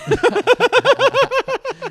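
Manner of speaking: shrieking burst of laughter; a high, helpless laugh; cracking up high. Real, not acted. Big laugh at cabbie joke.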